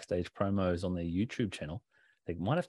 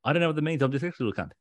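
again mashed into one breath, slightly raised; imitating Ospreay's one-breath ramble; run together as one word; 6 semitones higher, 4 dB louder, much faster than usual. Shown as a demonstration. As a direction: gabble it like one long word. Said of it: "That's how he said it".

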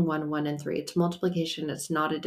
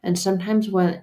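normal talking voice

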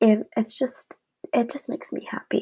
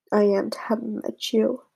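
normal talking voice

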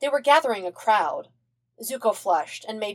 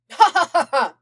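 high, loud evil princess cackle; haughty villain laugh; 10 semitones higher, 8 dB louder than usual. Acted. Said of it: "pretty good Azula laugh".

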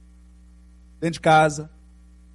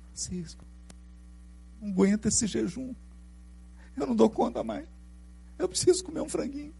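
mock whiny friar; 5 semitones higher than usual. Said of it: "E aí eles".

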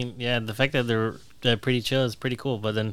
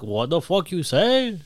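slow, higher-pitched mock affront; groggy challenge; 6 semitones higher, slower than usual. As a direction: drag out an exaggerated offended question. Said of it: "you're half asleep".

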